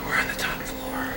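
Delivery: hushed, slow whisper. Real, not acted. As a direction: breathe it out, barely voiced. Repeats it in the dark.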